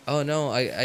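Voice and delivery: ordinary talk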